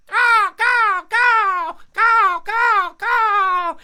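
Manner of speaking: high, blaring cartoon caws; loud crow caws. Crow answering the children's call, loud high cawing. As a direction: caw loudly like an arriving crow.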